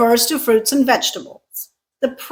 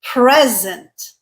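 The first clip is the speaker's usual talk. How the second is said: "present" as PREZ-ent; stress on first syllable. Shown as a demonstration. Teacher gives the noun, "present" stressed on PRES. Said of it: "pronounce the same word this way".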